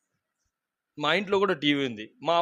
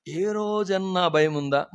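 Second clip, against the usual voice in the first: ordinary talk, nothing marked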